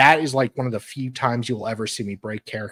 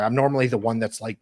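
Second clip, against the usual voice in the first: usual speaking voice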